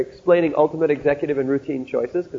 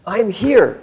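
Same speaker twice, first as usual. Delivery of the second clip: pained plea; 5 semitones higher than usual. Retold or imitated. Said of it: "he gave me this sort of pained expression".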